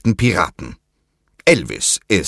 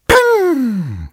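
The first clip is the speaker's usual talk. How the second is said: high sharp ping of a firecracker; imitated bang. A narrator's reading. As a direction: pop it out like a bang. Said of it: "gleich noch einer".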